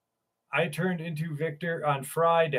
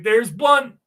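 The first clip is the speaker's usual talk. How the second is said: higher and louder, teasing the name; playful call-out; 6 semitones higher, 6 dB louder than usual. Retold or imitated. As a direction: sing out the name like a private joke. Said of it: "I'm like".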